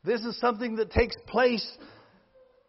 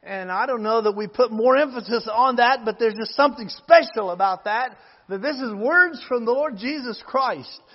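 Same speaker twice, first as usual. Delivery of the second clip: normal talking voice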